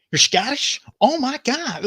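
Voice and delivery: high, astonished outburst, imitated; mock amazed delight. Waiter learning the customers are Scottish, mock astonished delight. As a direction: imitate a waiter suddenly bowled over. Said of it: "you should have seen his face".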